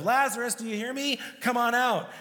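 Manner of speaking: high-pitched call, as to someone out of earshot. Retold or imitated. Jesus summoning dead Lazarus from the tomb, calling out. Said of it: "in a loud voice".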